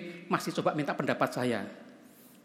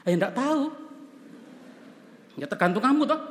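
blunt pastor; 10 semitones higher, 5 dB louder than usual. Retold or imitated. Pastor's blunt reply retold.

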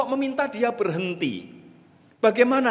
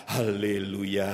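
relieved sigh; 10 semitones lower, 5 dB quieter, slower than usual. Acted. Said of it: "betapa leganya".

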